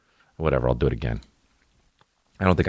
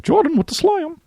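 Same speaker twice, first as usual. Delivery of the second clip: high-pitched voice; 5 dB louder than usual. Retold or imitated. Kid imitating a sportscaster after a dunk, high falsetto. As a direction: mimic a kid's falsetto sports call. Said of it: "Justin McKinnon went".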